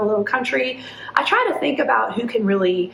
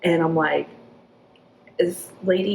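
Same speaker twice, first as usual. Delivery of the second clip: normal talking voice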